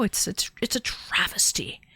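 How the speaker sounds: hushed, mock-grave lament; theatrical whispered dismay; mock dramatic whisper. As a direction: whisper it like a tragedy. Mock outrage at US internet.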